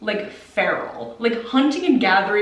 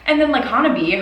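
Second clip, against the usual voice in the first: ordinary talk, nothing marked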